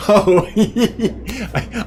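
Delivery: laughing warmly at a chat sticker; kind gentle laugh. Real, not acted.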